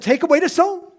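commanding call; loud, high command to open the tomb. Acted. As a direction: proclaim it with authority. Preacher voicing Jesus' command.

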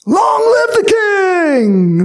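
high, slow, stretched cheer; crowd shouting. Retold or imitated. People acclaiming their new king, high drawn-out cheer. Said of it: "They're shouting out".

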